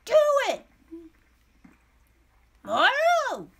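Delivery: high, loud attack cry; battle yell. Real, not acted. Gamer launching an attack, battle yell. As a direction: yell it out, stretching the last word.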